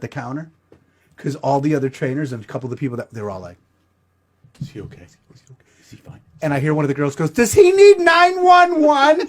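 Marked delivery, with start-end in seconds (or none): 4.6-6.2 hushed whisper, barely voiced; whispered gossip. Staff whispering about the collapsed man, hushed. 7.3-9.2 put-on high, slow, alarmed girl's voice; high worried girl. Gym girl asks if he needs 911, high and slow.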